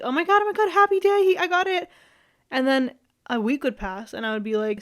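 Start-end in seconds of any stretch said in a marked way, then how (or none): 0.0-1.9 much higher, squealing with joy; mock giddy excitement. Imitating her giddy self when crush says I love you, high squeal. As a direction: gush like your dream came true. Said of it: "at first I'd be like".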